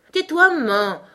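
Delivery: high, loud, cranky retort; shrewish snapping. A narrator's reading. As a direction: snap crossly at mother. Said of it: "d'un ton acariâtre".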